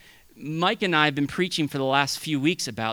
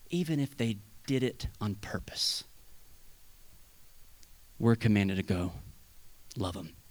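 hushed whisper, much lower; 7 semitones lower, 8 dB quieter than usual. Real, not acted. Preacher whispering to the congregation about the fallen. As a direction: lean in and whisper it.

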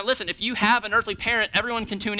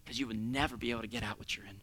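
much lower, much softer tender reply; voice of God; 9 semitones lower, 15 dB quieter than usual. Acted. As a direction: answer like a gentle father. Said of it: "And he goes".

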